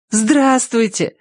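high, drawn-out delighted hello; joyful greeting. A narrator's reading. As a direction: greet a small friend joyfully, pitched high. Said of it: "радостно сказала дверь".

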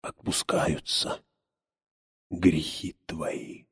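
slow whispered blessing; whispered absolution. A narrator's reading. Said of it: "и негромко произнёс".

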